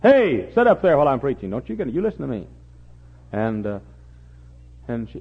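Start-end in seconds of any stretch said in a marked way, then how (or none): 0.0-2.5 put-on calls out loud and high at a fidgeting girl; scolding preacher shout. Preacher scolding a girl in the pew, shouting. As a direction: bark the order across the room to the child. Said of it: "He said".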